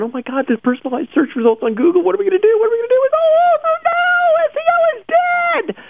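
mock hysterical despair, slowing to a high wail at the end; mock panic. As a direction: wail like the sky is falling. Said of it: "they're going".

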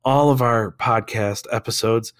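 rough, strained lost voice; hoarse voice. Real, not acted. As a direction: croak on through hoarseness.

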